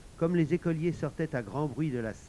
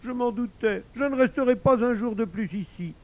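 high, hurt outburst from the wife; hammed-up wounded threat to leave; tearful reproach; 10 semitones higher, 7 dB louder than usual. A narrator's reading. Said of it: "en tamponnant ses yeux du coin de son mouchoir".

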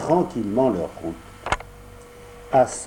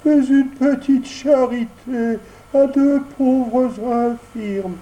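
much higher, whining begging voice, slow; tearful fox; 11 semitones higher, 3 dB louder, slower than usual. Acted. Sly fox begs Pinocchio, whining with put-on tears. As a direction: beg with fake tears. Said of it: "avec des pleurs dans la voix".

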